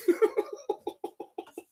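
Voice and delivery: wheezy laugh. Real, not acted. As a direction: break into a breathy giggle. Laughs during the call.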